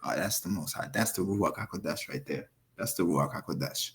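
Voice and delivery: real reverent near-whisper; hushed. Real, not acted. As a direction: drop to an awed hush. Hushed reverent aside about God.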